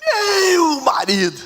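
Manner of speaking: high, long-held "meu" falling away; imitated adoring cry. Shown as a demonstration. Preacher modelling the woman for the sisters to imitate, adoring cry. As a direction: imitate her wail, drawing out "meu". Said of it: "quem vai imitar essa moça".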